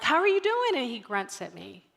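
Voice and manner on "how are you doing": high, louder upbeat mom question; cheery greeting. Retold or imitated. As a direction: greet your kid brightly, pitch rising. Mom greeting child in car.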